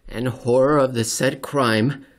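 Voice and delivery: normal talking voice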